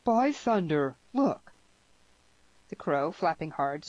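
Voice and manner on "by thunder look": loud, higher burst of amazement; startled shout, pitch jumping up; exclaimed. A narrator's reading. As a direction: exclaim it in astonishment. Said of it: "Peyton exclaimed".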